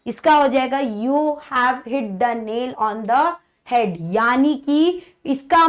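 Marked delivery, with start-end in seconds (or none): 0.8-3.9 drawn out, word by word; teacher dictating a quiz sentence slowly; slow example read. Shown as a demonstration. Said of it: "example sentence read".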